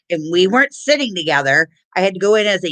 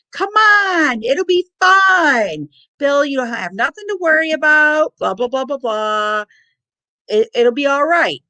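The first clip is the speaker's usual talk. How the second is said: coaxing aunt; 7 semitones higher than usual. Retold or imitated. Aunt talking nervous dad onto the ride, high coaxing. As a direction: imitate an aunt breezily coaxing, pitched way up. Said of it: "my Aunt Wally's like".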